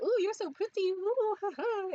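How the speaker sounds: two moms fawning over her, high cooing; gushing praise. Retold or imitated. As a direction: fawn over her with a giggle. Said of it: "they both were like".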